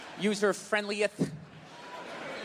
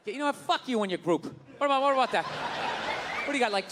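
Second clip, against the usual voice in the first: ordinary talk, nothing marked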